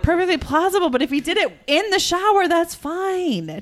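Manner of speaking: amused exasperation. Real, not acted. Mock exasperation at teen son.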